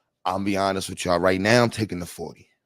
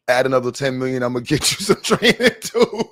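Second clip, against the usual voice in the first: laughing; 3 semitones higher, 4 dB louder than usual. Real, not acted. Joking offer, laughing through it.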